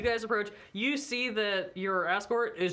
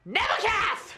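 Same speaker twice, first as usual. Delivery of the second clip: high, loud shrieked call; shriek a name; 13 semitones higher, 6 dB louder than usual. Acted. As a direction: shriek the name through the door. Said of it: "shrieks this".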